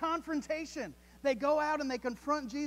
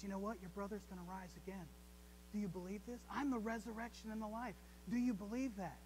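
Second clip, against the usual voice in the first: much softer, tender reassurance; quiet, comforting voice of Jesus; gentle Jesus; 9 dB quieter, faster than usual. Retold or imitated. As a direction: speak it softly to someone in mourning. Jesus gently answering grieving Martha.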